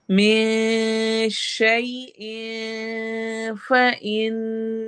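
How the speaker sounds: slow, drawn-out tajweed chant; Quran recitation. Shown as a demonstration. Teacher recites verse for students, slow tajweed chant. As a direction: recite it slowly, stretching each sound. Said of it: "मिन में इख्फा करना है".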